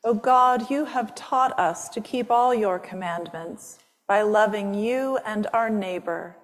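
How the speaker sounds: ordinary delivery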